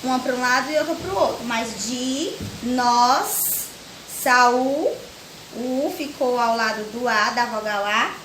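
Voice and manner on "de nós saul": "de, nós, Saul" as held separate beats of dinossauro; syllable by syllable. Shown as a demonstration. Teacher counting the word's four syllables, syllable by syllable. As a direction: draw out "de", "nós", "Saul" with gaps. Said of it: "ficou quatro".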